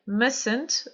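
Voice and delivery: "Mustn't" without sounding the first t; silent middle T. Shown as a demonstration. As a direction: say "Mustn't" as "mussn't", dropping the middle T. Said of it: "je ne prononce pas le T du milieu".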